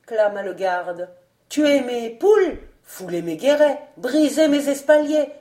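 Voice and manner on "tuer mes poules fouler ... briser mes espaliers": loud, higher angry outcry; shouted in chorus. A narrator's reading. Servants crying out in chorus, loud and high. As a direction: shout the grievances together. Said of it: "crièrent en chœur".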